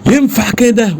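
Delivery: high, sharp outraged question; retold indignant yell. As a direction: call out the officers' inaction. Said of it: "وعمال أزعق".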